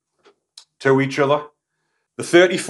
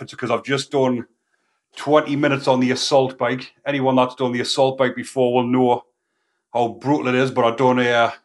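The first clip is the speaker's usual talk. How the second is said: ordinary delivery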